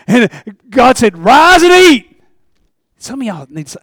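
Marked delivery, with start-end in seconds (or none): 1.2-2.2 booming voice of God; slow, loud and high, a proclamation from heaven. Retold or imitated. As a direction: call it out slowly like God commanding. God's command in Acts story.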